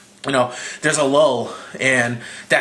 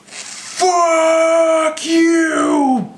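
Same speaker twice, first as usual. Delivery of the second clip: screamed very high and loud; full-throated mock Hulk scream; furious shout; 4 dB louder than usual. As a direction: roar it at the monster. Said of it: "and he's just like".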